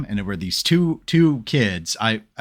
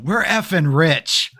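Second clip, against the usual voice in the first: put-on slow, higher, gleeful kid whisper-shout; mock gleeful kid; 6 semitones higher, 3 dB louder, slower than usual. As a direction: savour the words like a kid who struck gold. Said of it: "he didn't say effing though".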